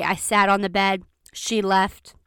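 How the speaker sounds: ordinary delivery, nothing marked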